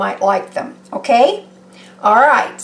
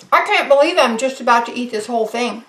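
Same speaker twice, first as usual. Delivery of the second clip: normal talking voice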